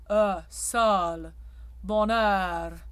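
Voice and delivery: bonheur with a wide open eu; open mixed vowel. Shown as a demonstration. Coach demonstrating the open OE to singers. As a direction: sol with an open O. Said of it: "The O E in French is very open".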